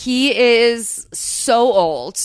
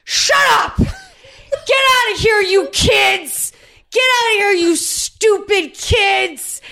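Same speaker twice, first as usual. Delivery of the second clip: put-on much higher, louder furious yell at kids, slow at the end; angry old man shout; 11 semitones higher, 5 dB louder, slower than usual. Host retelling the old singer yelling at teens, mock furious shout. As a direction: bellow at the mocking kids like a cornered old man. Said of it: "And he was like".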